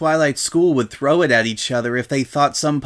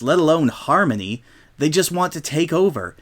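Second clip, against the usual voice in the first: usual speaking voice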